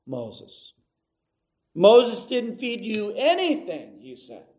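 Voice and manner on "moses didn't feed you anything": raised, forceful correction; a firm rebuke, pitched up; emphatic Jesus. Acted. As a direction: set them straight firmly. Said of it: "He says it emphatically".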